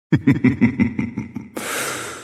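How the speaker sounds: breathy mocking boss laugh; evil chuckle. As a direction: scoff at the mortal with a laugh. Boss taunting after challenge.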